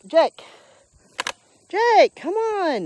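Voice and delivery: high, loud, drawn-out call; exasperated dog call. Real, not acted. Owner calling a dog that won't come, exasperated shout. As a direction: holler for the dog to come, stretching it out.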